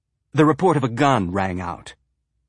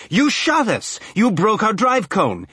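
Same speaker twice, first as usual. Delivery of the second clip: furious outburst; 11 semitones higher than usual. Acted. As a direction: cut her off in a rage. Owner cutting in furiously.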